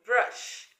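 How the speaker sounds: "brush" said with uh, almost whispered; teacher showing the mistaken "brush" under her breath; wrong brush. Shown as a demonstration. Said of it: "We don't say".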